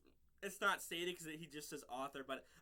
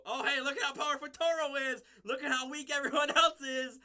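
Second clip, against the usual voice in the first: loud, high sarcastic cheering; mock hype; 6 semitones higher, 7 dB louder than usual. Vlogger parodies cheering for Toro's power, loud and mocking. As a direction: shout it like an over-the-top fan.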